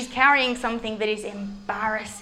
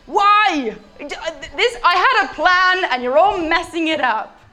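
frustrated woman; 6 semitones higher, 5 dB louder than usual. Acted. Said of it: "She's like".

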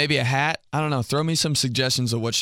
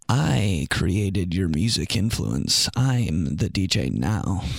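lower, slow, self-important; smug boasting; 6 semitones lower, much slower than usual. Retold or imitated. Friend who picked the song gloating to the others, smug and slow. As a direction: lord your music taste over your friend.

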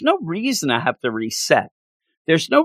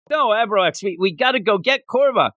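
Tony voice; 8 semitones higher than usual. Retold or imitated. Tony replying in acted skit.

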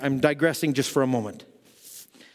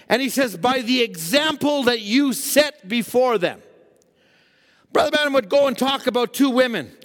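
raised-pitch, emphatic sermon storytelling; preaching; 9 semitones higher than usual. Real, not acted. Preacher telling of Herodias teaching her daughter, high emphatic preaching. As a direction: tell it as a warning from the pulpit.

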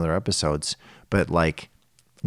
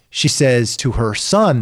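usual speaking voice

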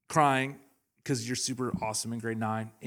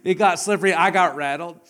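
1 loud emphatic admission; 5 semitones higher, 12 dB louder than usual. Real, not acted. Emphatic confession after laugh.

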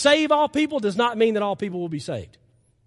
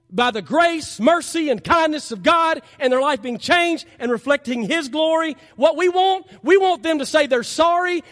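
rising preaching; 6 semitones higher, 5 dB louder than usual. Real, not acted. Preacher building to a loud point.

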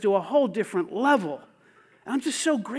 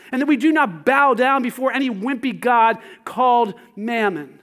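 ordinary talk

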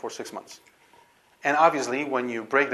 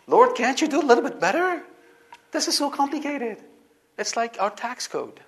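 much higher and louder, a pleading complaint to God; exasperated plea; 11 semitones higher, 5 dB louder than usual. Acted. A believer pleading with God over complicated laws, high exasperated whine. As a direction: whine up to the Lord, fed up with the rules. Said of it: "somebody would say".

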